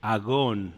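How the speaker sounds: agón said with g sound, stress on the last syllable; Greek word agón. Shown as a demonstration. Pastor teaching a Greek word to the congregation, carefully. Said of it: "Y se pronuncia agón".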